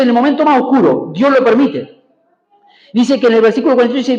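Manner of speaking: ordinary talk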